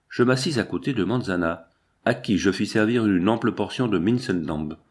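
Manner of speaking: ordinary delivery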